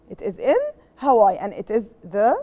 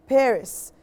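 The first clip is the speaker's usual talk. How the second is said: ordinary delivery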